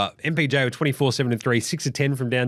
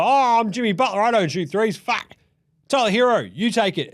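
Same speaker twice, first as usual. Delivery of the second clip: higher, mock whiny star passing it off; Jimmy Butler voice; 8 semitones higher than usual. Podcaster imitating Jimmy Butler passing to Tyler Hero, mock whiny. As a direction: ham up a player dodging the shot. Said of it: "And he's like".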